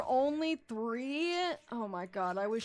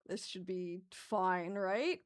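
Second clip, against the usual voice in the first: ordinary delivery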